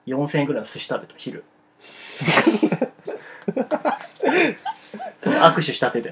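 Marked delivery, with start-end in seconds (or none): 1.8-5.2 high rising burst of laughter. Real, not acted. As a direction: laugh out at your own story. Laughing at own sushi story.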